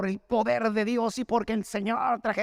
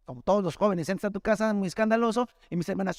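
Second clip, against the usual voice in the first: ordinary talk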